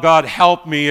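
loud, slow desperate cry; Jonah's loud plea for rescue; crying out to God. Retold or imitated. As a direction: call up to God from the depths. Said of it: "when he cries out".

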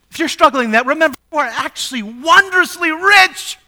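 preaching at full force. Acted. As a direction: preach it out to the whole church.

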